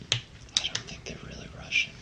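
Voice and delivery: hushed, unvoiced-breath whisper. Real, not acted. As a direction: whisper your doubt so only your partner hears. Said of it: "I don't know why we're whispering".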